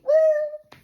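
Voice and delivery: high, loud "woo" cheer; party whoop. Acted. Reliving a club night with friends, high loud whoop. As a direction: whoop like on a dance floor.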